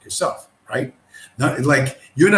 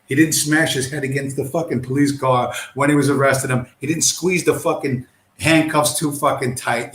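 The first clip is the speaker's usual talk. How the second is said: normal talking voice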